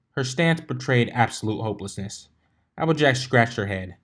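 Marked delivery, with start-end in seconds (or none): none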